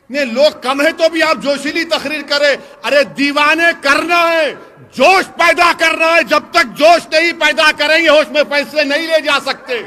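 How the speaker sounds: rising from firm oratory to a full rally shout; building to a shout. Acted. Politician stirring up his rally crowd, building to a shout.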